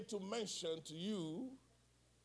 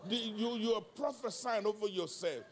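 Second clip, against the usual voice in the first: raised, loud exhortation; full-force preaching; 4 semitones higher, 6 dB louder than usual. Real, not acted. Preacher urging congregation to prophesy over themselves, full-force preaching. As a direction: urge them on, high and loud.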